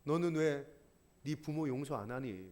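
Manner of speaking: low, hushed plea from the cross; soft pleading question. Pretend. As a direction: ask it softly, almost grieving. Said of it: "십자가가 소리 지르고 있는 거예요".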